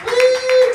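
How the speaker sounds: a loud, high cheering whoop; comic whooping to the crowd about tattoos. Real, not acted.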